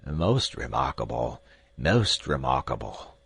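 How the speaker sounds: put-on a dotty old scholar mumbling; muttering to himself. A narrator's reading. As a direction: drift off, lost in thought. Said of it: "strolled off muttering to himself".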